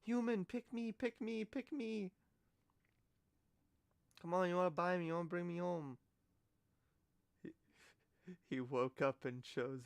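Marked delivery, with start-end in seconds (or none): 0.0-6.0 higher pleading kitten begging to be chosen; needy kitten voice. Retold or imitated. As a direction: beg like a kitten wanting adoption. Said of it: "It's like".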